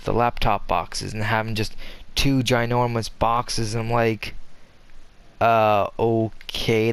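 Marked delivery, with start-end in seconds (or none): 5.4-6.9 slow, drawn-out sceptical reaction; mock hesitant acceptance; dubious. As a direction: stretch it out, unconvinced. Acted hesitant reaction to odd change.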